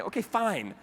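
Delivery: high-pitched grudging mom imitation; flustered, annoyed mom. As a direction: give in as a slightly irritated mom. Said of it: "sort of flustered and a little annoyed".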